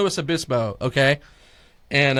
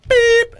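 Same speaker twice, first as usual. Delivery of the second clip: very high, loud beep; imitated beep; 10 dB louder than usual. The answering machine tone, loud high squeaky beep.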